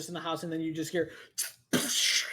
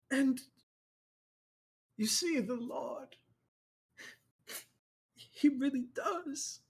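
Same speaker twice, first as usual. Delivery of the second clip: preacher voice; 7 semitones higher than usual. Acted. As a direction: praise the flock with solemn pride. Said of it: "And he goes".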